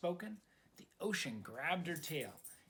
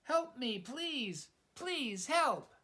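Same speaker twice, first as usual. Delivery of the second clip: shouting back; 8 semitones higher, slower than usual. Acted. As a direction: call for help, pleading and high. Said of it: "Sorry shouted back".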